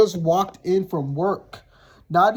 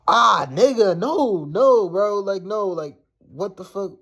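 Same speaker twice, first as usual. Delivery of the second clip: dismayed protest; 4 semitones higher, slower than usual. Retold or imitated. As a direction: retell a startled, appalled refusal. Acted dismayed realization of his meaning.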